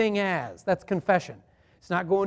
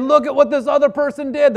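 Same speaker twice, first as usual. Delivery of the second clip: higher, louder finger-pointing jab; blaming retort; 6 semitones higher, 6 dB louder than usual. Retold or imitated. A preacher voicing a feuding party blaming the other, loud. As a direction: throw the blame like a grenade. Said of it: "They're saying".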